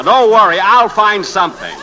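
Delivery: high, loud, still laughing; laughing reassurance. Acted. Reporter calming his fiancée while laughing.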